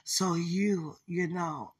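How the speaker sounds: ordinary delivery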